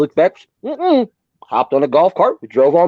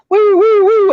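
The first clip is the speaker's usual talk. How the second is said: much higher, louder siren woo; car alarm imitation; 5 dB louder, slower than usual. Describing car alarms after the blast, a much higher siren woo. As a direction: mimic every car alarm going off.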